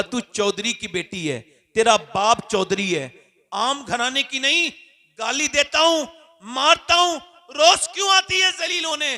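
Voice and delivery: scornful rebuke. Acted. Chaudhry berates the visiting daughter.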